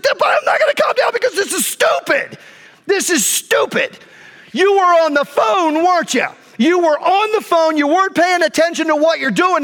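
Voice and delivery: high, ranting indignation; retold furious rant. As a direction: replay a tirade at a careless driver. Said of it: "I was so mad".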